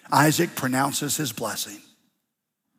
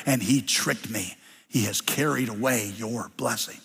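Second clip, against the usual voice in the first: sad father; 6 semitones lower, 5 dB quieter than usual. Acted. Isaac tells Esau sadly.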